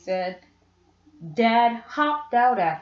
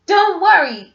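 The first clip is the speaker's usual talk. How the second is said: high, loud reassuring call down the slope; 8 semitones higher, 8 dB louder than usual. Acted. Boy calls down to his climbing dad, loud and high. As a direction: call down to him to keep going. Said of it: "Jimmy called".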